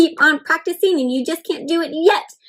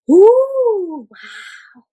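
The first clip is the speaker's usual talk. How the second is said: excited ooh; 6 semitones higher, 5 dB louder than usual. Acted. Marveling at the dancers.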